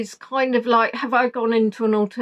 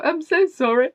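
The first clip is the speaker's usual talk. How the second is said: apologetic; 7 semitones higher, 3 dB louder than usual. Retold or imitated. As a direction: coo a sorry to your pet. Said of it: "I was apologising to Apollo".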